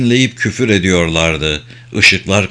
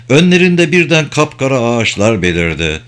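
plain reading voice

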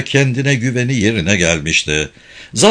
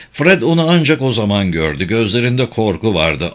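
ordinary delivery, nothing marked

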